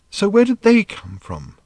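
put-on high, slow young girl's question; Random, curious teen. A narrator's reading. Random asking Arthur where people came from, high and slow. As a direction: ask it like a puzzled teenage girl.

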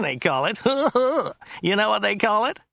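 high, whiny driver with a chuckle; Joe's whiny voice. A narrator's reading. Driver amused by his own word, high, whiny and chuckling. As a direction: whine it high, chuckling midway. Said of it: "His voice was rather high and whiny".

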